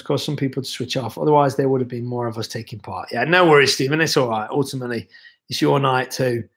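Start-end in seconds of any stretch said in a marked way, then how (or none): none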